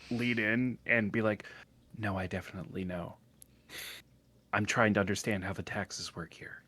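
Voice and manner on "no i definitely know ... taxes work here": low, soft near-whisper; hushed aside. Acted. Cutting into a private chat about taxes, hushed whisper. Joins the whispered in-character exchange.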